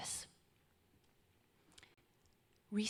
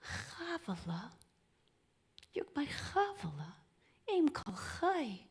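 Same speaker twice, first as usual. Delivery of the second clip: tender, not angry; 7 semitones higher than usual. Acted. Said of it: "instead of having rage, instead of getting angry".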